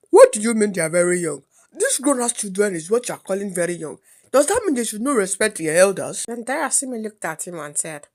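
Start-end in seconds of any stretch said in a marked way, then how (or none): 0.1-6.2 mildly indignant uncle, hurried at first; a touch of outraged scolding; angry drunk uncle. Acted. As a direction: take offence as the disrespected elder.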